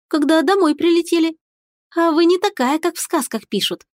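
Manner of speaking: put-on high, curious little girl; a small girl, openly curious. A narrator's reading. Girl talks to witch.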